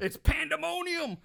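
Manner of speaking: high, theatrical exclamation; hyped-up drama. Real, not acted. Host describes the panicking crowd, excitedly dramatic. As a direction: cry it out over the chaos.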